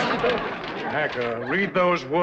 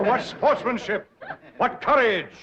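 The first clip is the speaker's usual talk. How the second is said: gushing praise; 8 semitones higher than usual. Acted. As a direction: lay the praise on thick. Banker gushing over rival's bravado.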